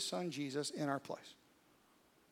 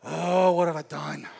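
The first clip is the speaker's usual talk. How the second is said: anguished cry; 12 dB louder than usual. Acted. Voicing regret of sinner.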